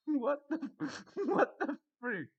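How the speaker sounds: high, laughing disbelief; laughing outburst of disbelief; disbelieving what the freak. Real, not acted. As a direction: squeal it in amused shock. Reacting to weird post.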